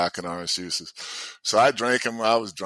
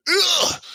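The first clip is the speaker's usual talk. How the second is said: imitated high, sharp grunt; effortful pitcher's grunt; 4 dB louder than usual. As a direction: grunt like hurling a fastball. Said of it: "He's grunting".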